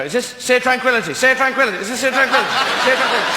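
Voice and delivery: lost astronaut. Acted. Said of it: "wind down the wind and go".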